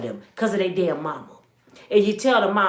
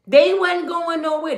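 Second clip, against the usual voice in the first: usual speaking voice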